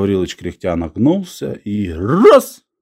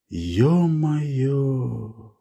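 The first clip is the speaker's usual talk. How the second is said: softened, drawn-out stunned exclamation; 3 semitones higher, 6 dB quieter than usual. A narrator's reading. Old man seeing gold in his palm, stunned. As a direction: let it out softly, in awe.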